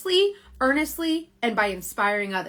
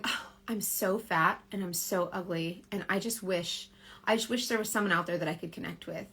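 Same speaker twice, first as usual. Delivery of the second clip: lonely past self; 3 semitones lower, 8 dB quieter than usual. Retold or imitated. Retelling her lonely past self.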